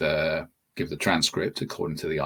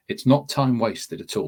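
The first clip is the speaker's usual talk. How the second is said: ordinary talk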